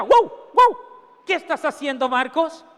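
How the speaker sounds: dog barking "Wow, wow" before it speaks. Acted. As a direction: bark like a dog, then ask. Dog barking then talking.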